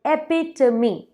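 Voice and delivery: एपिटमी said slowly as "e-pit-mee"; "एपिटमी" in four clear syllables, slow; correct epitome. Shown as a demonstration. Teacher slowly models "एपिटमी" for viewers. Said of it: "अब next word है मेरे पास एपिटमी".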